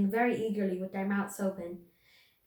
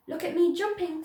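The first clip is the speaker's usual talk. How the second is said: high little voice, louder, Roo; a high squeaky little voice; 8 semitones higher, 4 dB louder than usual. A narrator's reading. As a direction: squeak it like a small child. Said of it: "squeaked Roo".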